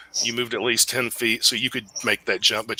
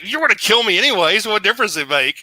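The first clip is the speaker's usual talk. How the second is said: fast, louder NPC retort; defeated enemy; 7 semitones higher, 5 dB louder, faster than usual. Acted. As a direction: fire it back quickly, exasperated. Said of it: "He's like".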